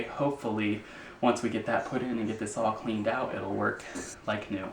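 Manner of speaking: winded, talking between breaths. Real, not acted. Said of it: "And I'm out of breath".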